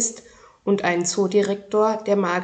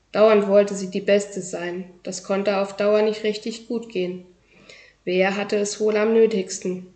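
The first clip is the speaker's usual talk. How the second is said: plain reading voice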